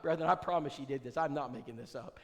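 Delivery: normal talking voice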